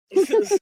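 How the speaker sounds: sudden high burst of laughter; loud laugh. Real, not acted. Laughs at own joke.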